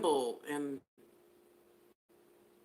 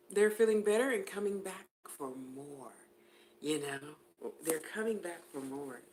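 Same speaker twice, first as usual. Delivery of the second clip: ordinary talk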